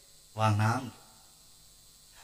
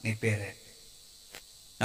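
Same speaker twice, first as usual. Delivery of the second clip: very slow, hushed, much lower and softer; God softly asking the weeping man; gentle voice of God; 9 semitones lower, 13 dB quieter, much slower than usual. Acted. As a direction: ask it tenderly, like a father drawing out a confession. God asks Jacob his name.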